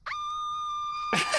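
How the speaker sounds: long, very high, loud shriek; re-enacted shriek of alarm, held out; horrified scream. Friends scream as waiter says her real name, horrified.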